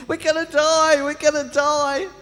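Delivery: high, loud panicked yelling; stranded castaway losing it, screaming in panic. Retold or imitated. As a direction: scream it like a man sure he'll die. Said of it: "started screaming and yelling".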